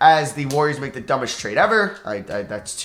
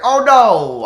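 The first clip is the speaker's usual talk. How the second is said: sudden loud dismay; dismayed shout; 9 semitones higher, 11 dB louder than usual. Real, not acted. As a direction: realize the mistake, yelp it. Sends the wrong player.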